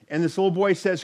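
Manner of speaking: ordinary delivery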